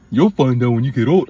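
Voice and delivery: mock elder. Mimicking elder's dismissive line.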